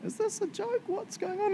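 high, incredulous squeak of surprise; retelling Dad stunned that people got saved early; shocked disbelief. Retold or imitated. High, stunned disbelief. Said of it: "he was literally like".

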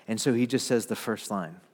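ordinary delivery

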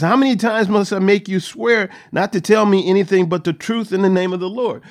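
a king losing his temper; angry king. Retold or imitated. King Ahab scolding the mocking prophet, loud and angry. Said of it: "Ahab gets mad".

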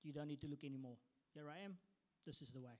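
ordinary talk

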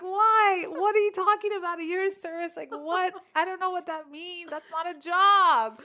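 high-pitched mock outrage, slowing on the last words; retold exasperation. Arguing with the inner voice about a year of service, high and exasperated. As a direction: protest up high, half laughing. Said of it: "I was so mad".

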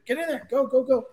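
high-pitched hurry-up call; urging the group to gather in for the camera. Real, not acted.

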